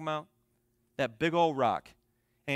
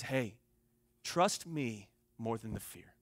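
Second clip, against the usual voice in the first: slow, low, soft reassurance; calm voice; 5 semitones lower, 4 dB quieter, slower than usual. Retold or imitated. Brother with arms out to scared nieces, calm and reassuring. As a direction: reassure gently, word by word. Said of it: "He's just talking to them in a calm voice".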